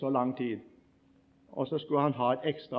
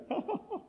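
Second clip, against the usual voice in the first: high mocking laugh; 8 dB quieter than usual. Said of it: "og så ler hele bussen".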